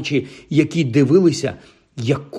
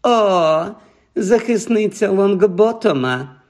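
високо, розтягнуто й глузливо; подражни її протяжним високим голосом; глузування; 7 semitones higher, slower than usual. A narrator's reading. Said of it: "глузливо мовила Пенсі Паркінсон". (глузливий тон)

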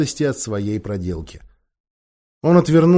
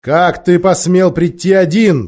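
loud, slow, menacing witch; terrible voice; 5 semitones higher, 7 dB louder than usual. A narrator's reading. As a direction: thunder it slowly, higher and louder. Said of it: "проговорила колдунья страшным голосом".

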